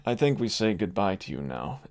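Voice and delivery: ordinary talk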